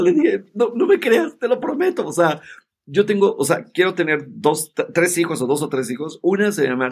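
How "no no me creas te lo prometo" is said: much higher, flustered denial; nervous pleading. Retold or imitated. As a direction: nervously swear you're telling the truth. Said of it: "me puse nervioso".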